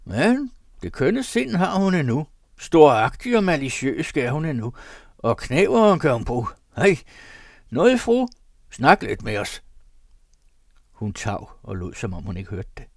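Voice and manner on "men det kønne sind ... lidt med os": high, loud taunting shout; husband loudly taunting his silent wife. A narrator's reading. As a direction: bawl it out to goad her. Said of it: "skønt han råbte højt nok".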